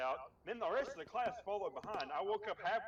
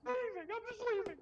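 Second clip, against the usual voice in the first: high-pitched imitation of a crying sub walking out; mock tearful; 12 semitones higher than usual. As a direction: wail it high, hammed up. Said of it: "she started crying".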